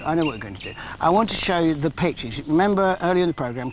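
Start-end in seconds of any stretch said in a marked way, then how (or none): 0.0-0.7 a lower, softer second go at the line; lower, quieter repeat. Real, not acted.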